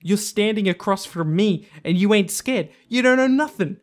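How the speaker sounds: mock tough-guy taunt that ends in a yell; mock Hellboy taunt. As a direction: growl it out like Hellboy sneering at a villain. Paraphrasing Hellboy's taunt, rising to shout.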